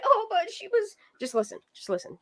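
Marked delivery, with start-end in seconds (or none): 0.1-0.9 high mocking voice of the lady; a lightly mocking impression of the lady; mock quote. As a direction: mimic her claim in a high put-on voice. Mocking the lady's claim.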